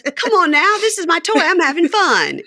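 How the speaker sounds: put-on high, loud pleading boyfriend; mock whiny protest. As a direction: protest like an overgrown kid defending a toy.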